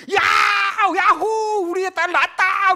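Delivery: high, drawn-out jubilant hollering; acted new parent's ecstatic shout; shouting joy town-wide. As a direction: announce it to the whole neighbourhood. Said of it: "막 이렇게 동네방네 이렇게 하는 기쁨".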